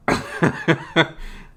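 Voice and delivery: loud, high burst of laughter; real laugh. Real, not acted. Host laughing at his own awkward interview clip, real laugh.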